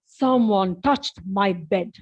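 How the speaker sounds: Papa Bear finds his bed touched. Acted. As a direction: drag it out deep like a big bear. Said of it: "Papa Bear said".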